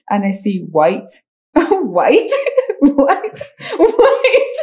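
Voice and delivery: building laughter. Retold or imitated. Retelling the ancestry results to a friend, laughter building from chuckle to high squeal.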